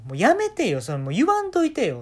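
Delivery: high, loud embarrassed protest; embarrassed daughter. Retold or imitated. (恥ずかしがって高い声で抗議する娘)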